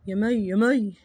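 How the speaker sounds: deep husband calling his wife; father calling. Acted. (زوج بيصوت على مراته بصوت تخين)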